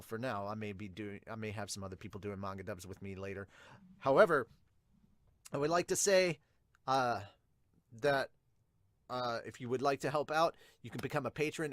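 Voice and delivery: ordinary delivery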